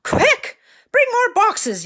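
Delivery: high panicked shout; yelling. Acted. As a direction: shout it like a frantic chef. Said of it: "yelled a man".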